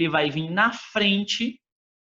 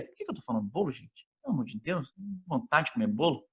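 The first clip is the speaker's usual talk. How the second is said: much softer, under the breath; muttered aside; 8 dB quieter, much faster than usual. Real, not acted. Teacher chiding herself over a slip, muttered aside. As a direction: drop the volume for a private grumble.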